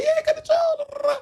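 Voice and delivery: high, drawn-out excited cheer; gleeful mock kid voice; happy little boy. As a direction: imitate a thrilled kid, voice way up. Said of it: "the little boy was happy".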